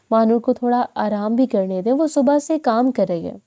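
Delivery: plain reading voice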